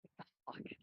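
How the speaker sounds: breathy whisper, no voice. Retold or imitated. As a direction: mutter it under your breath. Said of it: "And I'm just like".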